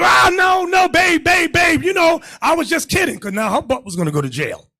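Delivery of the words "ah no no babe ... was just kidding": high, loud, frantic begging; mock pleading wife. Wife begging her husband not to press charges, loud and high. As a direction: plead with the babe, hammed up.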